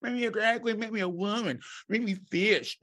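high, fast, gushing mimic of an eager friend; pleading to be painted up, mocked high and breathless; mocking wannabe queen. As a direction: ham up a begging, swooning wannabe drag queen. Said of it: "was like".

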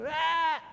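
very high mock tantrum yelp "Ah!"; toddler tantrum cry. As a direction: squeal like an angry little boy. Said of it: "he'll do it".

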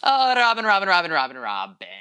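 loud repeated name, sliding steadily down in pitch; mock lament. Host despairing over a housewife's tax trouble, mock lament.

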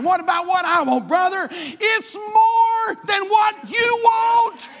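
rising, pressing preacher's call; fervent preaching. Acted. Preacher urging the congregation to stay focused, fervent. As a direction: drive it home to the congregation, building.

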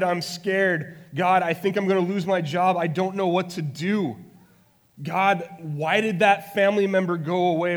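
loud, high, anguished cry to God; raw, impassioned crying-out prayer; desperate pleading. Acted. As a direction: plead as if everything is falling apart.

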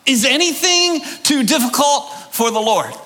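shouted, falling at the end like a declaration; proclaimed as a statement. Shown as a demonstration. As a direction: say it as a fact, not a question. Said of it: "made a statement in the form of a question".

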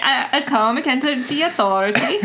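laughing through a hammed-up Swedish accent; mock Swedish accent, laughing. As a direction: ham up a Swedish official, giggling. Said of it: "Say that in a Swedish accent".

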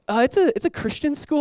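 put-on high-pitched, hesitant teen answer; retold timid admission; afraid to say. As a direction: stumble upward nervously, as if admitting it. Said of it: "I was, like, afraid to even".

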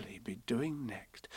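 slow hushed whisper. A narrator's reading. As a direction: whisper it conspiratorially. Kanga whispers aside.

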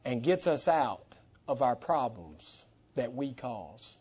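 low, soft pulpit appeal; quiet, measured preaching with long pauses; hushed preaching. Real, not acted. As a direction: bring it down and let each phrase settle.

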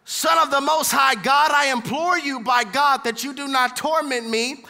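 pleading cry of the possessed man. Acted. Possessed man cries out loudly to Jesus. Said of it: "cried out with a loud voice".

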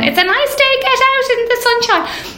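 high, sing-song Irish mammy voice; mother's voice. Retold or imitated. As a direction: chirp it like a nagging mammy. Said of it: "the little voice of your mother going".

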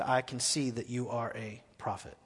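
low, hushed voice of dawning recognition; quiet, awed admission; subdued realisation. Acted. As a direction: let it sink in, very softly. Woman humbly recognises the prophet.

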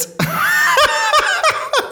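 real high-pitched cackle. Real, not acted. Comic cracking up at his rude punchline, high laugh. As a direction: burst out laughing at your own joke.

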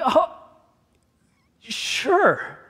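high, halting stunned reply; acted-out stunned acceptance. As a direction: agree while completely taken aback. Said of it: "I was stunned".

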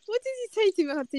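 high, startled what-did-you-say; mock incredulous question. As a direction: pitch it up in mock disbelief. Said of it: "I was like".

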